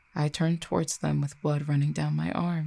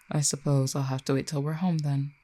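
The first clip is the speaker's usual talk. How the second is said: ordinary talk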